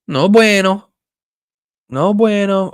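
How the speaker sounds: high playful groan of no bueno; mock dismay. Real, not acted. Streamer hit by another ad, playful high complaint. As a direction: tease the ad with a sing-song complaint.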